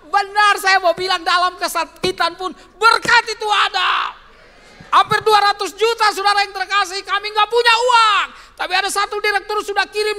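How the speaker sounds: loud, high fervent preaching; preaching at full force. Real, not acted. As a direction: drive it home to the church.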